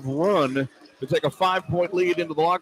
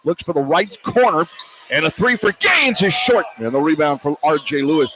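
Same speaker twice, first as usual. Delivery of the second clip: brisk, louder radio game call; live play-by-play; 5 dB louder than usual. Real, not acted. Radio announcer calling the game for listeners, lively play-by-play. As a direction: call each move as it happens.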